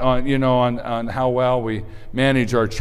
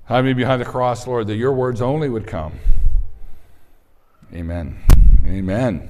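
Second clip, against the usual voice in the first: closing prayer; 5 dB louder than usual. Real, not acted.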